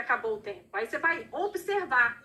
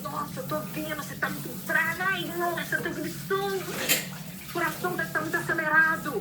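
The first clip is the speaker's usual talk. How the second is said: higher, a touch of echoed upset; mirroring mother; 5 semitones higher than usual. Shown as a demonstration. Mother mirroring her angry child, lightly worked-up and higher. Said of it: "Eu copio pra ela o que ela tá fazendo".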